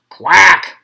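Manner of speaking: loud quacked reply; loud duck quack. A narrator's reading. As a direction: quack back loudly like a tired duck. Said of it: "The duck answered".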